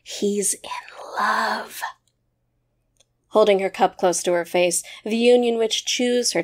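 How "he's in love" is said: soft, secretive stage whisper. A narrator's reading. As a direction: lean in and whisper it. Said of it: "she secrets her stage whisper".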